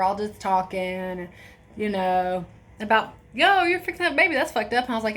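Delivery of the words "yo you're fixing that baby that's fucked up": teasing friends. Retold or imitated. Friends teasing her in hospital.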